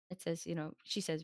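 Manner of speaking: normal talking voice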